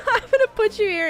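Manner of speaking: laughing. Real, not acted. Laughing while thanking a viewer.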